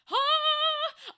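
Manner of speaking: high, loud drawn-out "ah"; frustrated cry. Real, not acted. Streamer tripping over words, frustrated high cry. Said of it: "I'm speaking too fast".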